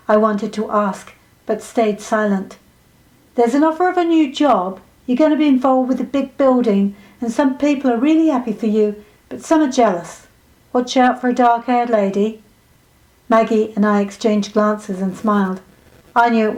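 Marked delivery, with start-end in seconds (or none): 3.3-12.4 fortune-teller. Acted. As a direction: voice the psychic delivering her reading. Fortune-teller's reading voiced.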